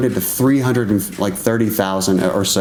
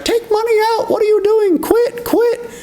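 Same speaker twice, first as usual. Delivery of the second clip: mocking the crowd. Everybody urging him to sell.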